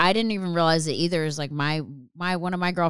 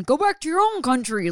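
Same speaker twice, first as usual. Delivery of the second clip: higher, sneering Karen voice; mocking Karen; 5 semitones higher than usual. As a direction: parody the overused insult.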